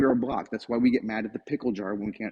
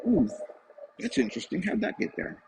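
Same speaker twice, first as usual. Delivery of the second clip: curious dog; 7 semitones higher, 3 dB quieter than usual. Retold or imitated. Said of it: "He'll go".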